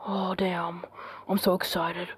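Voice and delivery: low, soft, nearly whispered; excited but keeping it very quiet; hushed excitement. Real, not acted. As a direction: say it under your breath in awe. Hushed anticipation before the premiere.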